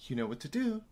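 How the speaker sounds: quiet threat. Retold or imitated. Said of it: "In his face".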